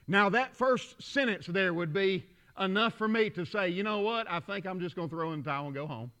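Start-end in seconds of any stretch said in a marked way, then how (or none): 3.7-6.1 low, quick, soft throwaway; resigned giving up. Acted. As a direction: let it sink away as if quitting. Acts out giving up.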